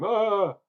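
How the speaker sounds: loud, high "ah" of self-reproach; exasperated groan. Real, not acted. Vlogger realising they miscounted the beers, exasperated groan. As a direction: groan out loud at your own slip.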